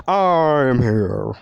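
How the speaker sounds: big, loud, drawn-out hero announcement; All Might. Acted. Announcing All Might's arrival to Deku, loud heroic impression. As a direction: attempt a booming superhero voice, stretching the words out. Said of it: "I can't do an All Might voice".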